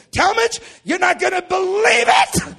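bursting out with incredible news; excited shout. Acted. Brother phoning the preacher at night, excited shout. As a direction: yell it high and very loud.

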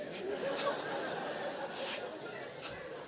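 high breathless giggle; nervous giggle. Retold or imitated. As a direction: squeak out a nervous laugh. Nervous audience member imitated.

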